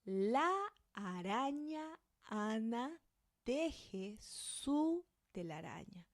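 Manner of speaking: "La araña Ana teje su telaraña" slow, every A the same; slow clear reading. Shown as a demonstration. Teacher reads a practice sentence for learners to repeat. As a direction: read it slowly, each A short and open. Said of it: "try to read this with me".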